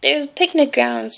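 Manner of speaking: usual speaking voice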